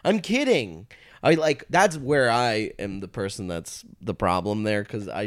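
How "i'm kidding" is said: defensive. Retold or imitated. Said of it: "And I was like".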